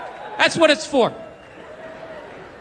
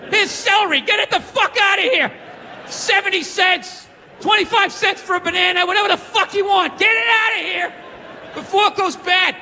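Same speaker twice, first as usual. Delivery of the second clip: pushy shouting vendor; 7 semitones higher than usual. Retold or imitated. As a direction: hawk the produce like a street vendor. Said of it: "supermarket vendor voiced".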